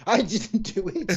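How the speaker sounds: put-on high, sing-song child denial; a small son swearing he didn't do it; innocent child. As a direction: play the little boy faking innocence. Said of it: "my youngest there going".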